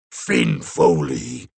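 very deep, slow monster call; monstrous rockslide voice. A narrator's reading. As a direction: boom the name out like a rock slide. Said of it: "Its voice sounded like a terrible accident, a rock slide crashing down".

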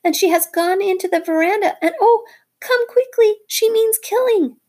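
very high, loud frantic bird scream; screaming in panic. Acted. Darzee's wife screaming to Rikki for help. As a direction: shriek for help. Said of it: "Darzee's wife screaming".

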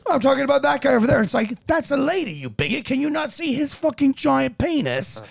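high, mock-outraged scolding; hammed-up indignant comic routine; retold comedy bit. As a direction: scold in exaggerated, high-pitched disbelief. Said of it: "He did kinda the same kinda joke".

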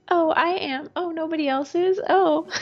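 mock sheepish. Imitating the widow admitting she can shoot, sheepishly. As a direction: imitate someone sheepishly owning up. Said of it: "like, sheepishly like".

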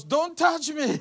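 yelped high and loud, pulling back; comic startled cry of don't touch me; high alarmed shout. Acted. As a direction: shriek it as if she's about to grab you. Jesus recoiling from Mary.

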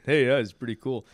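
light casual buddy mimic; breezy, lightly put on; a hint of the laid-back friend; imitating the friend. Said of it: "that's how he sounds".

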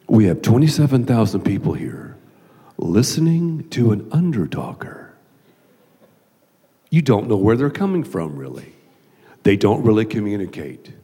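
low, weary inner monologue; deadpan inner aside. Retold or imitated. Pastor to himself during a hard interview, low aside. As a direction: drop low, thinking privately to yourself.

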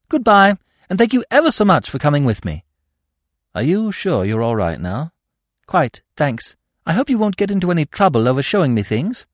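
chirpy thanks in a rush; cheerful girl. A narrator's reading. Girl thanks the narrator at the shops, brightly.

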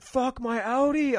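high, loud, slow mock outburst; mock outraged shout. Driver dismissing his wrecked Audi, hammed-up outraged shout. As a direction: ham up the driver's outrage over his car. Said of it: "he was like".

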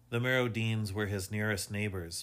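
ordinary delivery

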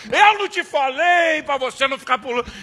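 hammed-up sermon; scolding father. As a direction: scold the kid hard. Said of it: "Dei sermão".

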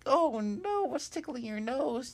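high, loud, worried little voice; worried Hop. Acted. Hop worrying about Ruff's nose. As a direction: fret in a squeaky voice. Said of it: "Hop's like".

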